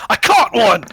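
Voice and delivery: high, very loud holler; triumphant shout. Acted. Druid player announcing an entangled enemy, shouted high and loud. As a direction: call out a catch to the whole party. Player calls out the catch in character.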